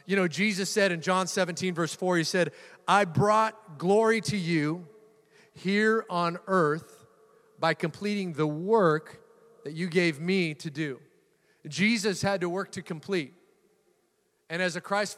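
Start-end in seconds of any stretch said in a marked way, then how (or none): 2.9-11.0 scripture reading. Acted. Quoting Jesus from scripture.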